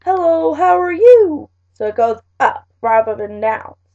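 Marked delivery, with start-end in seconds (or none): 0.0-1.5 "Hello, how are you?" pitched up and louder toward the end; rising intonation. Shown as a demonstration. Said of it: "your voice goes higher at the end".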